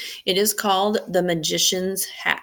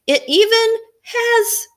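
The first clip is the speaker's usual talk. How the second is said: put-on high, loud kid's delighted discovery; amazed boy; 4 dB louder than usual. Matt finding his football book in the hat, high amazed boy. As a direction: gush like a thrilled boy.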